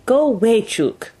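high, loud shooing call; sharp acted cry, dropping at the end; cried out. A narrator's reading. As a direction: order the dog off, French-Canadian accent. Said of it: "he cried".

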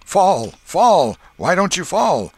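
very high, loud shout. A narrator's reading. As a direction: yell it mid-fight. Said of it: "Tom shouted".

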